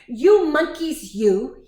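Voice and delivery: loud, slow storybook scolding; scolding peddler. A narrator's reading. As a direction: scold the naughty monkeys slowly and loudly. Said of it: "This made the peddler angry".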